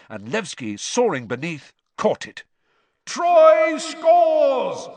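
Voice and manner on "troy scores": loud, high commentator's roar. A narrator's reading. Commentator Bagman roars a goal to the stadium, roaring. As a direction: roar it out, far above your usual pitch. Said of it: "roared Bagman".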